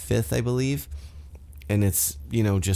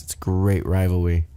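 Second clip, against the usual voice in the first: ordinary delivery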